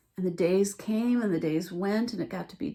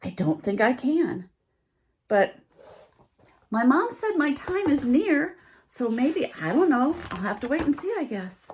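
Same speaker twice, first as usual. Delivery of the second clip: higher, louder wondering; unsure deer; 5 semitones higher, 5 dB louder than usual. A narrator's reading. Deer wondering if his time is near, unsure. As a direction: waver and trail off.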